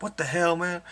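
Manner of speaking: high, startled outburst; shocked Booker T. Retold or imitated. Booker T finding Goldust in his bed, high mock-shocked outburst. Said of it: "and he's like".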